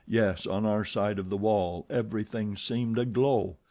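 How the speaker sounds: ordinary delivery, nothing marked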